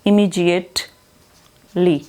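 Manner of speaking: "इमीडिएटली" said slowly as immee-jee-ate, pause, lee; clear pronunciation demo. Shown as a demonstration. Teacher clearing up the word for students, slow, split "इमीडिएटली" with a j. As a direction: break "इमीडिएटली" into slow parts with a j sound. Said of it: "इसको clear करना है".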